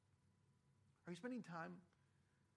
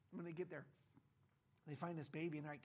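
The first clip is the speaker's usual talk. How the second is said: ordinary delivery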